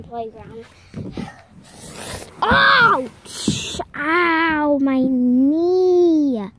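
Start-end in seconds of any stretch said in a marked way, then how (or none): 2.4-6.5 sudden pained yelps; hurt knee, crying out; tripping and falling. Real, not acted. Kid falls and hurts knee.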